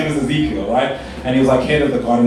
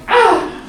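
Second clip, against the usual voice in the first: very high comic squeal; hammed-up high coo; mock seductive moan; 3 dB louder than usual. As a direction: squeak out a failed seductive sigh. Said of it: "like, seductive".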